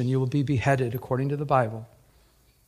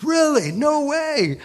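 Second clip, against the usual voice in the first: high, loud amazed reaction; floored disbelief; 11 semitones higher, 4 dB louder, much slower than usual. Retold or imitated. Stranger learns the man is a pastor, floored disbelief. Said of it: "he's just, I mean, floored".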